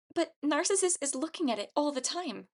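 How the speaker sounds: high character voice, protesting; worried objection. A narrator's reading.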